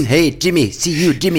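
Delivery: loud, high, emphatic greeting. Acted. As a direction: belt out the name. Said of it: "with so much emphaticness".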